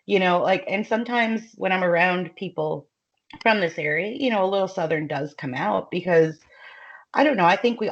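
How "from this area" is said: normal talking voice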